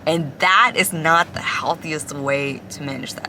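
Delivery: ordinary talk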